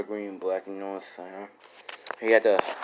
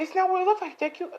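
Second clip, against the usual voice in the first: high squeaky protest; high-pitched caught-out girl; mock pleading girl; faster than usual. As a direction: falsetto excuse, hammed up. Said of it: "Eric said".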